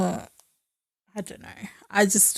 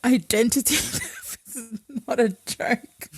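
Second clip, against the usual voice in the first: mock-serious line broken up by laughter; higher, laughing through the words; laughing quote; 4 semitones higher than usual. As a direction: crack up while quoting it. Quoting a show while cracking up.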